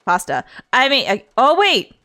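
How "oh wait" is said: ordinary delivery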